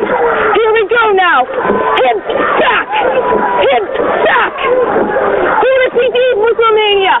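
all-out finish calls to the crew; rowing coxswain calls. Acted.

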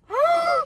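high sudden gasp; sharp startled gasp. Real, not acted. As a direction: gasp in alarm. Sees blood in mouth.

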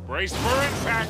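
shouted heads-up, pitched high; urgent warning. Acted. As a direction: call out a sudden warning over the noise. Warning passengers before a crash.

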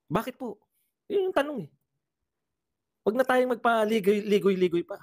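high-pitched, impatient challenge; insistent demand. Real, not acted. Commentator challenging congressmen to stop beating around the bush, insistent.

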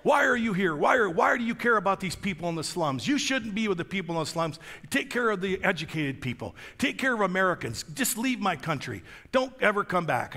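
high, agitated protest; diverting challenge. Retold or imitated. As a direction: imitate an irritated official sending someone off. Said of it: "He starts diverting".

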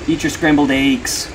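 slow, loud "eggs" drawn out as "aygs"; hammed-up, stretched "aygs" for "eggs"; mock regional pronunciation. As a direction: exaggerate "eggs" with a long AY. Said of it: "Who the fuck says it like that".

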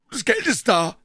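high call from above; shouted answer. A narrator's reading. Man at window to Puh, shouted answer. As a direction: holler the answer down.

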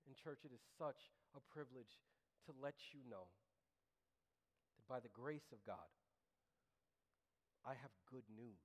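hushed, low sermon voice; gentle pastoral preaching. Real, not acted. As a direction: share it tenderly with the church, soft and low. Drops to tender address.

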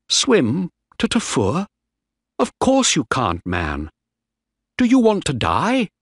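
high-pitched incredulous questions; alarmed disbelief. Acted. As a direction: challenge a friend's mad plan, pitch leaping up. Alarmed reply to friend's escape plan.

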